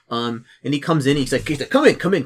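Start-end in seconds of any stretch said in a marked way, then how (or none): 1.7-2.3 higher, louder hurried welcome; eager welcome. Acted. Paladin inviting the newcomer into the outpost, eager. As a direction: usher them in eagerly. Paladin ushers visitor inside.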